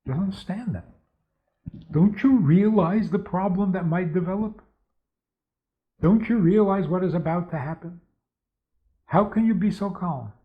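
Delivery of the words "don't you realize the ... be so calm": higher-pitched, imitated worrier challenging someone calm; anxious reproach. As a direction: play an anxious person's incredulous questioning. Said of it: "If you ask people who are anxious".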